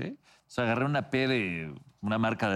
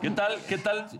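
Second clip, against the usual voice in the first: hammed-up greeting; 9 semitones higher than usual. Greeting the camera.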